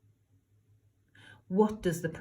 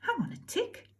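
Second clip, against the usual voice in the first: fairy voice; 12 semitones higher, 7 dB quieter than usual. Acted. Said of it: "The fairy said".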